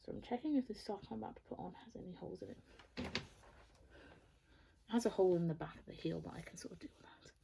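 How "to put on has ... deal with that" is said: soft unvoiced whisper. Real, not acted. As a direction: whisper it to yourself. Whispering about sock hole.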